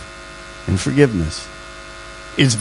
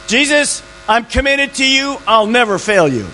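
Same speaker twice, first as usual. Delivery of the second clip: higher, earnest voice of Peter; a lightly voiced eager disciple; Peter's pledge; 7 semitones higher than usual. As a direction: Peter swearing loyalty, pitched up.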